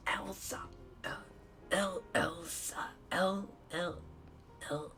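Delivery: high, halting snowman voice; Olaf. Acted. Struggling with an Olaf impression, high snowman voice. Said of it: "I'll try working on an Olaf impression".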